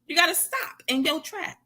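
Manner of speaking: ordinary talk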